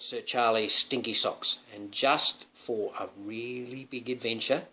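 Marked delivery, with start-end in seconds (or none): none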